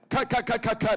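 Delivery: high, urgent rapid-fire calls of cut; frantic director. Retold or imitated. Retelling a director stopping the shoot, frantic and high. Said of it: "the director went".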